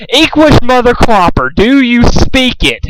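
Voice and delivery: high, loud, slow furious bark. A narrator's reading. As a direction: bark it at him like a drill sergeant. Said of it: "Pinkamena barked".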